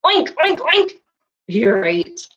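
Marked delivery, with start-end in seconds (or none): 0.0-1.0 high squeaky oink oink oink; playful piglet oinking. Retold or imitated. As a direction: oink like a cartoon piglet for the kids. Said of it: "what does he think the pig said back".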